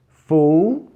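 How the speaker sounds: "Fo-" as a drawn-out FOH with a gliding O; long O sound. Shown as a demonstration. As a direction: stretch "Fo-" loudly into FOH-oo. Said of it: "por causa do O afetando o O". ("Fo-" com som de "fôu", bem alongado)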